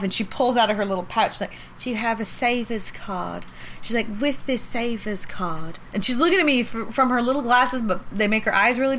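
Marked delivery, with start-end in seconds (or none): 1.8-3.5 lightly voiced old lady, slow and gentle; slow sweet old English lady. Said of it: "she's moved super slow". 4.2-5.8 lightly voiced old lady, slow and gentle; slow sweet old English lady. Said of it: "she's moved super slow".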